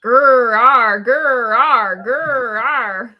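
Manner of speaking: loud mock growl, grr-ar repeated. Mocking an actor's growly line delivery with loud growls. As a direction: growl it like a tough-guy actor. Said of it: "He was just".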